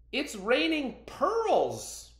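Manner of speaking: high, loud, slow cry of wonder; amazed cry. Acted. Ancestors marvel to each other at the storm, high and amazed. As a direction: call it out in delight.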